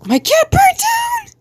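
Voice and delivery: very high, drawn-out squeal; slow, high-pitched wail; high squeaky cry. Acted. Reading out a silly comic line, high squeal.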